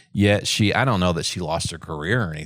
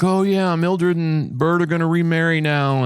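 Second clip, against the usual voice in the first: mocking optimist; 7 semitones higher than usual. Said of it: "you're like".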